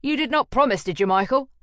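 loud, high demanding question; sharp accusation. Acted. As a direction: pin him down with it. Jackal accusing.

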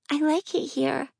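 put-on high little girl, pleading; pleading child voice.

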